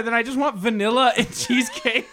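amused exasperated order; laughing insistence. Retold or imitated. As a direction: insist while cracking up.

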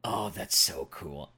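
low, slow brooding mutter; brooding fake-deep mutter. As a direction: mutter it like a moody edgelord. Mock-brooding reaction to edgy knight.

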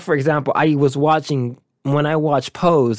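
normal talking voice

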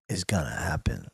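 soft, low ominous whisper. Retold or imitated. The client whispering his warning to the barber, low and soft. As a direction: whisper it like a threat.

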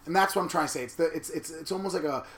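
ordinary talk

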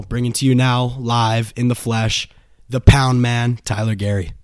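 loud, upbeat build-up to the guest's name; hype introduction. Acted. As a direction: announce him like a ring announcer, but still held back.

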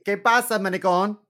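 loud, high, laughing "¿Qué pasa, maricón?"; loud mock greeting. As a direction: call it out to the bodega guy with a laugh. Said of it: "I'm gonna go to the bodega later today and say".

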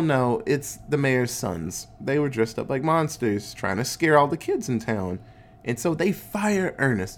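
ordinary talk

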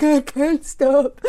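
put-on slow, whiny childish plea; whiny kid. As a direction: drag it out like a greedy kid. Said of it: "It's just like".

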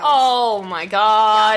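slow, drawn-out shocked gasp of words; stunned oh my God. Real, not acted. Co-host hearing the victim was pregnant, slow stunned disbelief. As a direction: stretch it out, floored by the news. Shocked by unborn child news.

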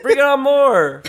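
high, egging-on mock cheer; gleeful cheering. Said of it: "It's like".

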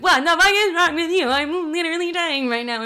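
high, loud mock-crying yell; hammed-up tearful fury; hysterical outburst. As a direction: wail it at mom in outrage. Retold hysterical fight with mom.

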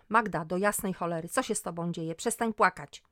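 loud, exasperated scolding; sharp reaction. A narrator's reading. Friend orders crying Magda to stop, sharply. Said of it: "ale zareaguj ostro".

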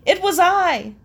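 high, loud mock-formal confession; imitated overly formal declaration; loud exclamation. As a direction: proclaim it pompously. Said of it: "forward and loudly and exclaimed".

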